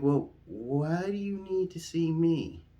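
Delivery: high, slow, drawn-out puzzlement; bewildered question. Retold or imitated.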